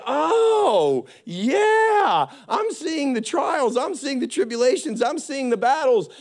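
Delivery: high, drawn-out mock war whoop, then eager listing; fired-up reaction. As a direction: act out being pumped for battle. Acts out fired-up reaction to trials.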